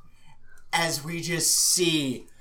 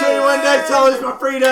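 excited yell; 8 semitones higher, 9 dB louder than usual. Real, not acted. Excited yell about wedding.

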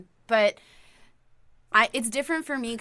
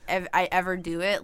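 usual speaking voice

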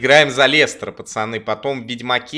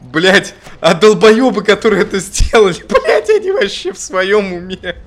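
loud, higher, laughing rant; 7 semitones higher, 8 dB louder than usual. Real, not acted. Indignant ranting outburst. (громкое возмущение)

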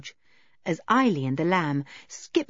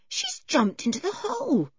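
amazed; 11 semitones higher than usual. Acted.

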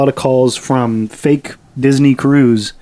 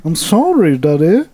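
put-on childish voice; 4 semitones higher than usual. Mock childish apology.

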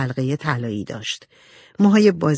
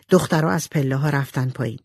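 ordinary delivery, nothing marked

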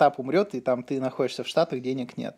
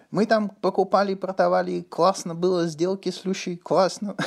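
lightly put-on Uzbek accent in Russian, raised; 5 semitones higher than usual. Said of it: "который плохо говорит на русском языке".

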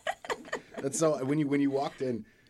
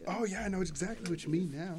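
high, louder pretend realisation; mock recognition; 12 semitones higher, 5 dB louder than usual. Host mimics locals who finally understand, mock recognition. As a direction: play up suddenly understanding.

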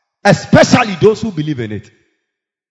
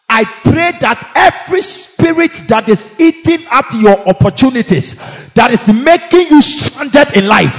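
loud high commanding prayer; shouting faith healer; 5 semitones higher, 8 dB louder than usual. Real, not acted. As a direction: shout the command with full force.

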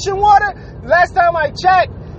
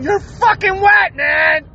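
exasperated yell; 6 semitones higher, slower than usual. Real, not acted. Vlogger ranting at swimmers who flee the rain, exasperated yell. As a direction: yell it like it's obvious.

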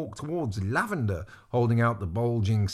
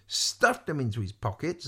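usual speaking voice

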